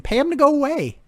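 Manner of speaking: high, loud exasperated outburst; mock-frustrated shout. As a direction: yell it at the team owners. Exasperated with the coach.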